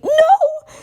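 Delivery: high desperate crying no; anguished shout. Acted. Spike refusing comfort from Twilight. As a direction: burst out in sobbing denial.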